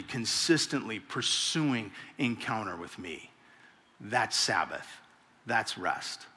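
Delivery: quiet and slow, letting it land; soft, slow emphasis. Real, not acted. Preacher landing the point softly and slowly. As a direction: drop it down and linger.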